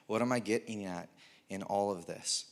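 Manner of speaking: usual speaking voice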